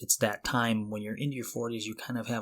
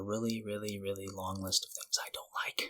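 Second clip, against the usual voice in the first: whisper; 3 semitones lower, 8 dB quieter than usual. Real, not acted. Comic confession about disliked things.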